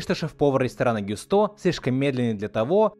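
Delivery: normal talking voice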